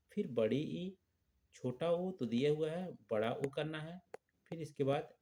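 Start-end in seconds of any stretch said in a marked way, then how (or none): none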